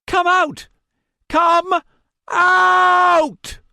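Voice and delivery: high, loud, crazed scream. A narrator's reading. Madman screaming at the paw to come out: high shrieking scream. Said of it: "Martin's voice rose to a scream".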